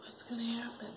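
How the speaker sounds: breathless whisper of suspense. Real, not acted. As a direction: hush to build suspense.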